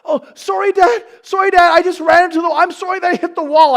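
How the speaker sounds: put-on high, loud childish apology; squeaky little girl. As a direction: play the flustered little daughter saying sorry. Voicing apologetic young daughter.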